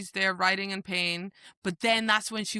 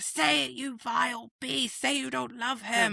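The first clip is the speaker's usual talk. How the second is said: higher, sneering villain voice; villain's demand; 5 semitones higher than usual. Retold or imitated. Villain demanding the heroine deny her love, sneering. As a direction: sneer the demand as the cruel queen. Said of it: "And she's like".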